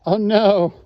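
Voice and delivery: loud shocked outburst; sudden loud dismay; dismayed cry. Real, not acted. Realises recording failed.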